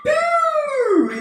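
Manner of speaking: very high, drawn-out "boo" whoop; imitated catchy noise. Shown as a demonstration. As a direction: let out a long, high-pitched, silly "booo". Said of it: "do something like".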